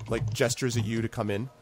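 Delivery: usual speaking voice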